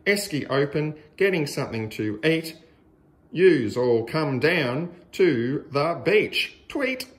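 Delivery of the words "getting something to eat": ordinary delivery, nothing marked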